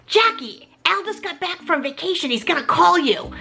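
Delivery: mom on the phone. Retold or imitated.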